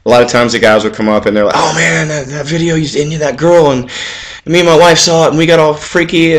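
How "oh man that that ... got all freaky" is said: lightly higher excited fan voice; gushing fan. Retold or imitated. Telling fans who gush at him, mock excited fan.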